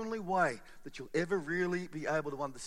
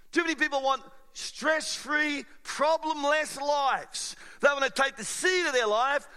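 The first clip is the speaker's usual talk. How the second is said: raised, driving sermon voice; preaching at full force; 7 semitones higher, 6 dB louder than usual. Acted. Pastor rallies the congregation, preaching at full force. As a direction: preach it hard to the room, pushing each line. Preaching about the seed and dirt.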